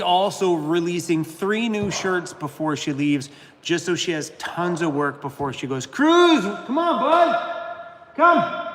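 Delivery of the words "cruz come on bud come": calling the dog. Real, not acted. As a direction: call the dog, stretched and high.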